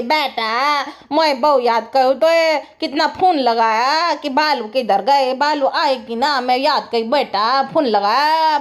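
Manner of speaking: put-on slow, old granny voice. Vlogger imitating the old grandmother fussing over her visit, slow granny voice. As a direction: drag it out like a doting old granny. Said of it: "बुढ़िया नानी के".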